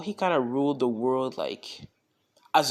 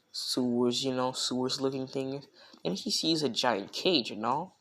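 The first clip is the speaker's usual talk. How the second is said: ordinary delivery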